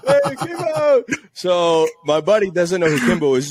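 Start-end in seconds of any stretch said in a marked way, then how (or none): 0.1-1.0 high, loud, hyped-up greeting; excited greeting. Retold or imitated. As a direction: call out to Kimbo all pumped. Greeting Kimbo in put-on voice.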